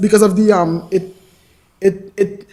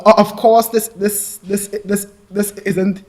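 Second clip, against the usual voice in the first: word repeated in a real stammer; halting, stuck restarts. Real, not acted. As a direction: stumble repeatedly before finishing the phrase. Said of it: "I may stammer from time to time".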